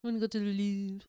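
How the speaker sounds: put-on snooty French-accented Louvre; mock French accent. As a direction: say it like a pretentious French tourist. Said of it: "the French accent".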